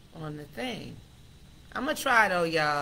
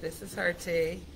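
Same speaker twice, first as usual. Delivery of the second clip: ordinary talk, nothing marked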